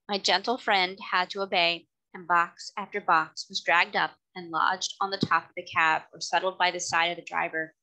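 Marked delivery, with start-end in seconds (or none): none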